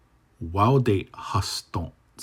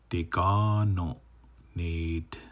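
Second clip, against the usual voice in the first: "Dekano" said with a lifted stressed syllable; teacher showing rising stress; much slower than usual. Shown as a demonstration. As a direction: push the voice up on "Dekano". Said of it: "So you do have an up stress".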